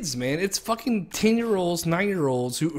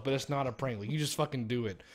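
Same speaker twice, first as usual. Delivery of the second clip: usual speaking voice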